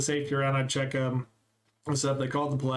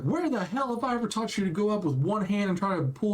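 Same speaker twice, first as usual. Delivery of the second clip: higher, indignant retold coach rant; coach ripping into him; 5 semitones higher than usual. Coach scolding freshman for one-handed catch, mock angry rant. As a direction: chew him out like a furious coach. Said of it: "Just ripping me".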